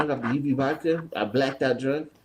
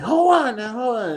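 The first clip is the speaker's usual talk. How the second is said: higher, louder mock call to wait; retold hyped "hold on"; 9 semitones higher, 4 dB louder than usual. Cousin eager before kicking the door, higher and louder. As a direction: pitch it up like a drunk guy getting ready.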